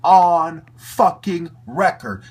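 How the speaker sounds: loud, slow, spitting out the names; furious yell. Real, not acted. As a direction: shout them down with disgust. Ranting, naming the collaborators.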